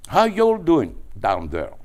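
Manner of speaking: high, slow, loud mock Southern drawl with a laugh; imitating a Southern American drawl. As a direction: stretch it out like a Georgia caller. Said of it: "the Southern people have a drawl".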